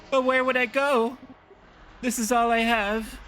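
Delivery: put-on high-pitched old lady's plea; pleading old woman. Pleading old woman being evicted.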